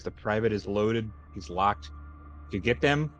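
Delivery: ordinary talk, nothing marked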